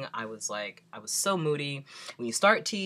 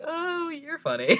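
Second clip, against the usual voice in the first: high, slow, laughing mockery; mocking laugh; 12 dB louder, slower than usual. Mocking the nurse who called them funny, laughing high. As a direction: throw it back at her through a laugh.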